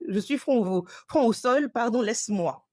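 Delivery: high, pleading, worn-out whine; mock-dramatic tired plea; exhausted plea. What she wanted to tell doctor.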